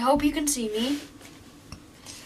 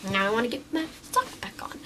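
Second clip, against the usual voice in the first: whispering; 4 semitones lower, 7 dB quieter, faster than usual. Real, not acted. Hushed aside while putting sock on.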